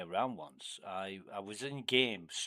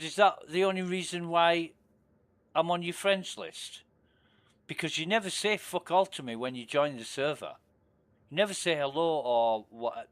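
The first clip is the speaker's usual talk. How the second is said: higher, louder, accusing; retold confrontation; 5 semitones higher, 5 dB louder than usual. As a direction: had it out with him, voice raised.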